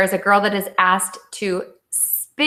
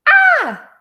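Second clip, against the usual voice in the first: shrill-pitched "Ah!" scream, much higher and louder; 6 dB louder than usual. A narrator's reading. Scared daughter screams back at her mother, high startled shriek. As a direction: shriek "Ah!" like a frightened girl. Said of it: "she screamed too".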